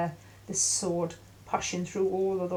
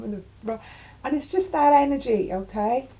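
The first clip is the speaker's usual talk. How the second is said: usual speaking voice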